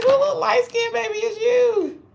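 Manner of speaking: high, loud laughing-cry squeal; delighted squeal. Retold or imitated. Gushing over the beautiful dream baby, high laughing squeal. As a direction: gush over the baby, voice breaking with glee. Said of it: "I was like".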